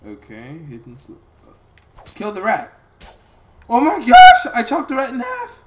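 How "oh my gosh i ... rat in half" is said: high loud shocked outburst; surprised exclamation. Real, not acted. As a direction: blurt it out in shock. Startled at chopping rat.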